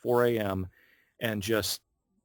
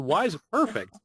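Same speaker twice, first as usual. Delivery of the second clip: retold puzzlement; 8 semitones higher, 3 dB louder than usual. Retold puzzled question back to director.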